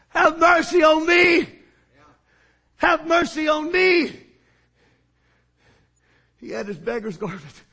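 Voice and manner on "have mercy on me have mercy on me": loud plea. Acted. Beggar cries to Jesus.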